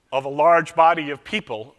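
ordinary delivery

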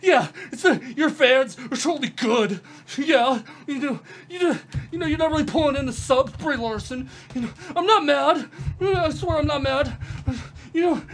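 high, loud mocking through laughter; laughing sarcasm. Real, not acted. She taunts Jeremy's clip, laughing mock sympathy. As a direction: taunt him while giggling.

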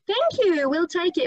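bright, fun, acted-out thanks; lively intonation. Shown as a demonstration. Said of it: "It would be so much more fun".

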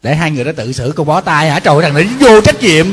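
loud, exasperated scolding of a careless son; a monk loudly scolding an irresponsible son in jest; loud mock scolding. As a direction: raise the voice and tell the boy off, half joking. Scolding the sons, half joking.

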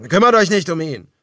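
desperate defiant shout. A narrator's reading. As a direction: draw their attention with a shout. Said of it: "schrie er ihnen zu".

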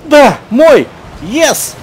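high, loud cheering with laughter; ecstatic celebration. Real, not acted. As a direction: celebrate the goal wildly. Scores goal, celebrates.

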